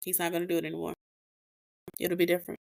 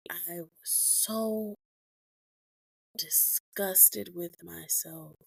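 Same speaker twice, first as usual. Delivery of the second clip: slow, softer disgust; 7 dB quieter, much slower than usual. Real, not acted. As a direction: drag out "I was so disgusted with myself", quiet. Confessing shame, slowed and hushed.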